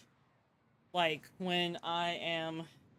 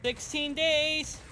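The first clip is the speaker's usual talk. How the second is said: drawn-out excited cheer; 7 semitones higher, much slower than usual. Real, not acted. Celebrating countdown, drawn out.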